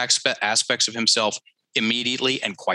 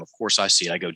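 normal talking voice

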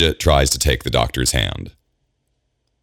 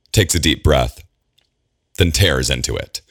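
usual speaking voice

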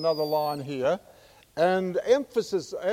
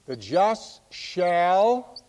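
The just shall stretched very slowly, hanging on shall with a rise; slow teasing cue; drawn-out lead-in; 2 semitones higher, much slower than usual. Shown as a demonstration. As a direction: hold shall up in the air so they fill in the next word. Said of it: "you know what the emphasis is here".

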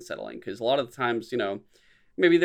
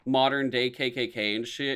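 ordinary talk

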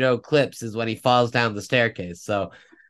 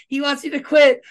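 casual reporter; 13 semitones higher, 6 dB louder than usual. Retold or imitated. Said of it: "the reporter's like".

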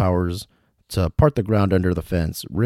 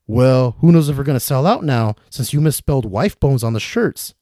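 pointed, louder jab; glaring; 6 semitones higher, 5 dB louder than usual. Acted. Nick to Paul about the misspelled shirts, glaring. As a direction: needle him about the typo. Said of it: "glaring daggers at Paul".

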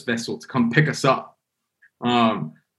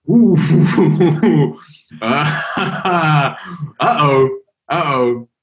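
nervous laughing; 3 semitones higher, slower than usual. Retold or imitated. Retelling panic at sea, laughing.

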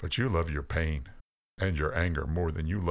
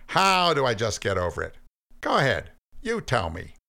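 higher, louder incredulous voice of Cain; skeptical Cain; 6 semitones higher, 4 dB louder than usual. Acted. Cain scoffing at God's advice, incredulous and raised.

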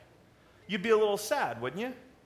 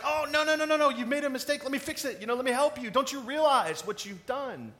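gentle worried host; 7 semitones higher than usual. Acted. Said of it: "It was like".

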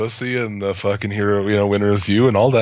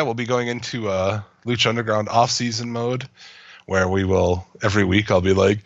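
ordinary talk, nothing marked

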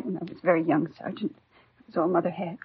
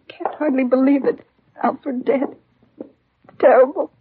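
grief rising; 4 semitones higher, 11 dB louder, slower than usual. Acted. Widow's grief swells after officers leave.

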